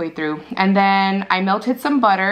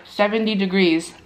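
talking around a bone; bone between teeth. Real, not acted. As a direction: lips parted, teeth clenched. Seen 3: Wing bone held between teeth from 1.0s; before that, hands only.

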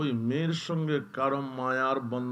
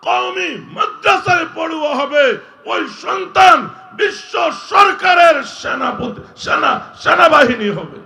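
thundering high sermon shout; preaching at full force; 12 semitones higher, 18 dB louder than usual. Acted. Preacher to the gathered crowd, thundering sermon shout. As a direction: roar it out to the whole crowd.

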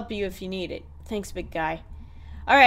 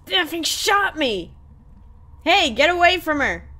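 raised, higher voice in protest; angry outcry; 5 semitones higher, 6 dB louder than usual. Acted. Character just shot warns attacker off a friend, angry and loud. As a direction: call out sharply at an attacker. Acted confrontation after a shooting.

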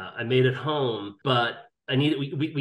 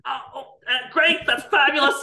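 much higher, squeaky delight breaking into a laugh; hammed-up thrill at the surprise pay; retelling his giddy reply to the boss; mock delighted; 7 dB louder than usual. Reacting to a bigger salary.

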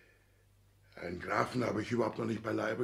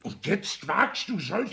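higher, slow, building; rising anger; 6 semitones higher, 4 dB louder, slower than usual. Acted. Father building to a rebuke before he is cut off, angry. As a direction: lean in threateningly.

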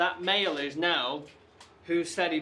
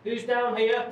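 raised, high challenge; calling out; 8 semitones higher, 5 dB louder than usual. Real, not acted. Investigator asks who is down here, loud high call. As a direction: call down an empty tunnel. Calls out into tunnel.

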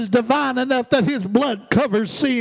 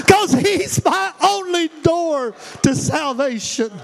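higher and louder, building to a shouted climax; preaching at full force; 4 semitones higher, 3 dB louder than usual. Acted. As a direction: preach it with rising force, slowing at the end.

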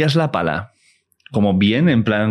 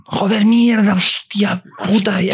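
mock Tourette outburst; 11 semitones higher, slower than usual. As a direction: blurt the swearing like uncontrollable tics. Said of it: "¿Pero yo síndrome de Tourette?".

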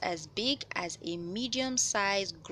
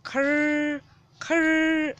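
loud, higher rasping mock snore; imitated snore; 6 semitones higher, 8 dB louder than usual. Shown as a demonstration. Showing children how a blocked nose sounds in sleep, loud mock snore. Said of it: "That's the sound you hear if we can't breathe easily".

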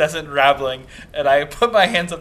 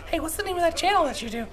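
high, quick mom voice; 4 dB quieter, faster than usual. Retold or imitated. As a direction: pitch it way up like mom. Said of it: "That's my mom's voice".

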